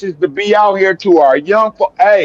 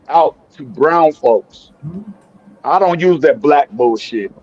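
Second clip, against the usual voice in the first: ordinary talk, nothing marked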